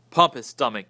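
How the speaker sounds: loud yell. A narrator's reading. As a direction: call out loudly in alarm. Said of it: "yelled Elwood".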